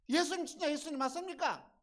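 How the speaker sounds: high, loud, urgent asking; excited cry across a distance; Peter's astonished question. Acted. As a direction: shout it out in amazed disbelief.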